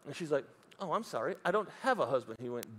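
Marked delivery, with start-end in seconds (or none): 0.8-2.4 acted soft, meek admission; sheepish reply. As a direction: say it small and quiet, as if caught out.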